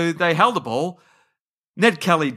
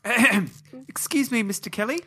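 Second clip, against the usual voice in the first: timid schoolteacher; 6 semitones higher, 4 dB quieter than usual. Acted. Schoolteacher approaching the outlaw Ned Kelly, meek and hesitant. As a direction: clear the throat and ask meekly. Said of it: "he's a school teacher".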